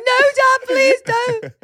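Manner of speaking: put-on very high, wailing childish plea; mock pleading. Naughty kids begging dad, high mock pleading. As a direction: act out a mortified kid begging.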